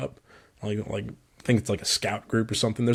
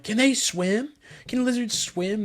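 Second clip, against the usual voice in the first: put-on little girl voice; 12 semitones higher than usual. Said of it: "She's like".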